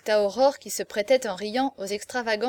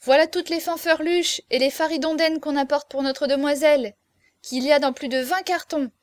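raised, higher calling voice; shouted announcement; 4 semitones higher, 5 dB louder than usual. A narrator's reading. Berrichon announcing deliveries from the threshold, crying out. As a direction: call it out loudly from the doorway. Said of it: "cria dès le seuil".

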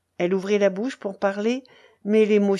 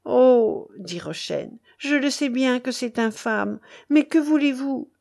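higher, helpless pleading; pleading admission; 5 semitones higher, 3 dB louder than usual. A narrator's reading. Rochaine excuses herself to the outraged baron, pleading. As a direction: admit it and plead for understanding.

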